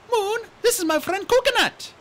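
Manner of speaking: put-on high, bright young dragonette voice; chirpy excited young dragon; eager little RainWing voice; Kinkajou's voice. Kinkajou introducing friend.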